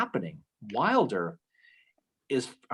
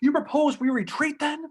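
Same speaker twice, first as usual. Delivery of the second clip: high-pitched retold yell; acted angry yell; 12 semitones higher, 3 dB louder than usual. Host acting a commander yelling at his general, indignant. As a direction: challenge him, voice jumping up. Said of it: "he yells at Polk".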